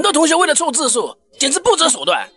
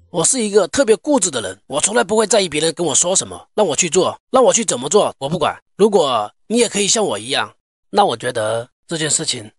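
low cool voice; 7 semitones lower than usual. Acted. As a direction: act the stubborn cool guy. Cool pose speech, very low.

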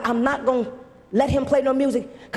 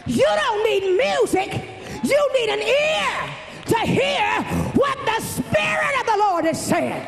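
preaching at full force; 11 semitones higher than usual. Acted. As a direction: hammer it home to the congregation, shouting.

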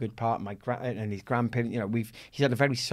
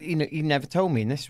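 ordinary delivery

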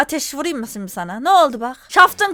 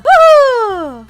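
high, loud "woohoo" cheer; excited whoop; 11 semitones higher, 9 dB louder than usual. Real, not acted. Streamer cheering at payday in the game, excited whoop. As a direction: let out a long, ringing whoop.

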